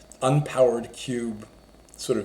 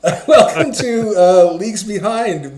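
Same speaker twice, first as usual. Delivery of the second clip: laughing; 8 semitones higher, 10 dB louder than usual. Real, not acted. Laughing into show intro.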